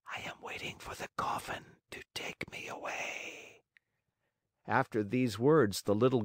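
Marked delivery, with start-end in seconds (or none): 0.1-3.6 barely audible, as if already gone; whispered. Acted. Azure-haired maiden whispers to Pinocchio from the window, faint whisper. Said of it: "she whispered".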